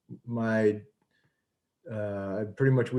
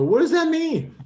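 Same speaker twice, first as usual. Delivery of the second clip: much higher and louder, baffled; incredulous question; 8 dB louder than usual. Retold or imitated. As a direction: jump up in disbelief. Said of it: "I would look at guys and go".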